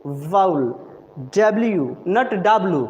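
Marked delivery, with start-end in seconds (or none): 0.0-0.6 वाउल said low, lips rounded on "vaul"; वाउल spoken slowly for the class to copy; correct pronunciation. Shown as a demonstration. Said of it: "मेरे lips को आप लोग देखेंगे".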